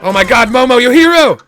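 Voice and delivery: high, loud delighted praise; excited. Real, not acted. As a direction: gush over the hero. Cheering Momo.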